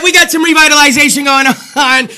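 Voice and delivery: breathless, winded talk; out of breath. Real, not acted. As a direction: talk through shortness of breath. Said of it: "now I'm getting out of breath".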